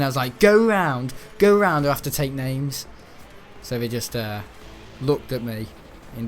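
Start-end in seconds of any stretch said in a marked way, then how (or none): 0.4-2.8 shouting. Retold or imitated. Prefect shouting at pupils to use other stairs, loud. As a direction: bark the order at the pupils. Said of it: "I was actually shouting".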